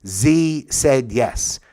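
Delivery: slow teacher-style reading of sample sentences; slow example sentences. Shown as a demonstration. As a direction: read the example out slowly and clearly. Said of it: "So these are pronouns".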